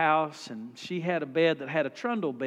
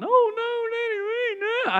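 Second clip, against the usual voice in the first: put-on high, loud wailing like little boys; mock bawling kids; 5 dB louder than usual. Preacher's boys begging not to share a room, hammed-up bawl. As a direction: wail it like a scared little kid. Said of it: "they started crying and bawling".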